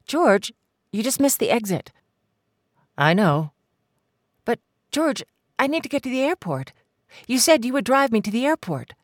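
high, urgent woman's voice pressing George; Mary's anxious voice. Acted. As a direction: push him, rising alarm. Mary pleads with George.

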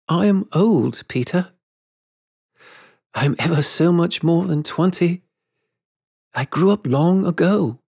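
high-pitched, halting confession; gentle woman's high voice, with hesitant pauses; grown Wendy. A narrator's reading. Wendy confesses she has grown up.

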